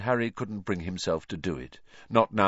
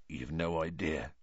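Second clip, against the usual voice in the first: hushed and much softer; quiet; 3 semitones lower, 9 dB quieter than usual. Acted. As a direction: say it low, haunted by memory. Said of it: "said Hagrid quietly".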